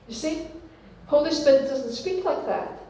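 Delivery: normal talking voice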